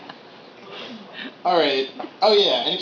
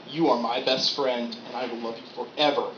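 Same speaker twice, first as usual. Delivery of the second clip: loving dog; 5 semitones lower, 6 dB quieter than usual. Retold or imitated. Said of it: "you can just see, like".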